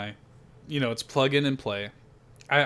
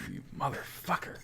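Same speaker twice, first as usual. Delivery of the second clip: hushed, voiceless swearing; muttered curse; 12 dB quieter than usual. Real, not acted. Host realizing the co-host tricked him, muttered curse. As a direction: curse the prankster under your breath. Cursing the prankster under breath.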